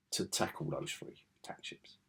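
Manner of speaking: breathless hushed voice; whispered aside. Real, not acted. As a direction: drop to a whisper.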